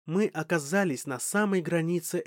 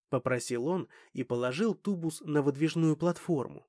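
ordinary delivery

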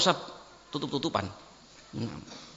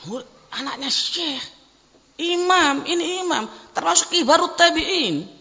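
awed exclamation; 10 semitones higher, 3 dB louder than usual. Retold or imitated. Student marvels at the bride's father.

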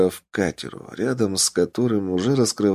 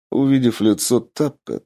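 ordinary delivery

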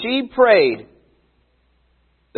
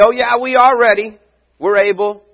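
eager boast; 6 semitones higher, 3 dB louder than usual. Retold or imitated. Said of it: "they say".

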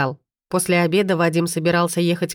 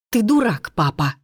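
hissing; 4 semitones higher than usual. A narrator's reading. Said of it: "шипит мажорка".

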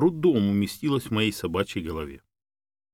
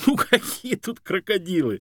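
high, amused protest; smiling disbelief; 10 semitones higher than usual. A narrator's reading. Man dismissing talk of crocodiles, amused. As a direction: brush the fear off with a smile. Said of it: "улыбнулся Юрий".

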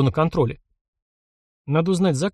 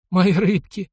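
ordinary delivery

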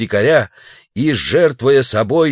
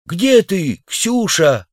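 a hint of calling across the hall; 9 semitones higher, much slower than usual. Savage calling for his wife in the hall, lightly raised call.

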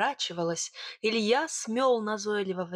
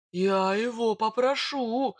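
slow, drawn-out threat; heavy, deliberate warning; menacing bogatyr; 2 semitones higher, 3 dB louder, slower than usual. Acted. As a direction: stretch the words out with menace. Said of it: "зверем глянул на Сидора".

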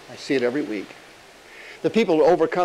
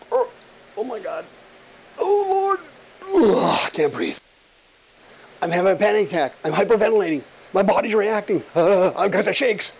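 mock panic; 4 semitones higher than usual. Acting out a panic attack.

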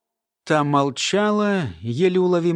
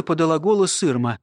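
usual speaking voice

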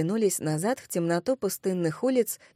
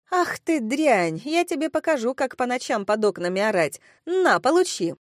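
outraged scolding; 4 semitones higher than usual. Acted. Woman at window threatens noisy girls, outraged. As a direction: build from outrage to a high final attack. Furious woman throws water down.

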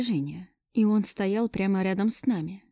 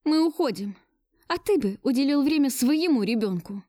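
higher-pitched, bitter retort; bitter snort; 6 semitones higher than usual. A narrator's reading. Woman announcing to Gleb she is leaving, bitter. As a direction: throw it at him with some bitterness. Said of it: "С горечью фыркнула я".

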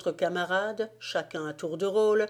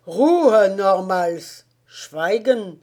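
barked Ruhe, much louder; shouted German order; 9 dB louder, much slower than usual. A narrator's reading. Guard officer shouting the prisoner down, loud German bark.

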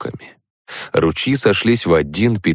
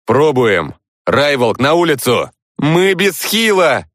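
high, loud urgent call; shouting; 8 semitones higher, 3 dB louder than usual. A narrator's reading. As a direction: shout orders across the room. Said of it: "крикнул Женя".

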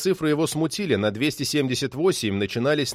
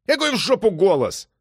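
high, heated question; irritated outburst; exasperated; 8 semitones higher, 4 dB louder than usual. A narrator's reading. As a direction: snap back in disbelief. Exasperated cop snaps at thief.

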